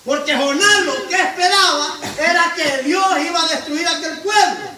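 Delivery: loud, high, rousing sermon voice; full-force preacher calling the flock to God; fiery preaching. Acted. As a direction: preach it out to the whole church.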